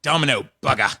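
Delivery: mock Australian. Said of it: "Australians come through".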